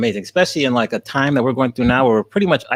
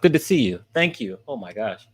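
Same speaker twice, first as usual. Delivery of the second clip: usual speaking voice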